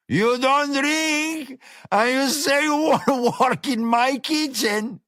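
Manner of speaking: high, slow, bellowing disbelief; mocking shout. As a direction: laugh it in his face. Gomez laughing, mocking shout.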